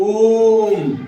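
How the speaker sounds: ordinary delivery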